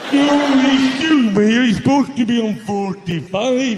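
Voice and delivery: slow, dragging, distorted impression; loud opening sagging into a slow drag; record at wrong speed. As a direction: stretch words like a record running slow. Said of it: "you're not playing at the right speed".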